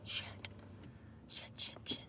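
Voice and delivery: panicked whispered swearing; whispered cursing. Real, not acted. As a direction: hiss it under your breath. Realizes she forgot something.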